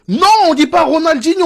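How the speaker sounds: high-pitched imitated protest over Ronaldinho; mock objection. Brazilians pestering him about a name, mock objection. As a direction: play an exasperated pedant correcting "Ronaldinho". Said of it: "s'ils venaient nous faire chier".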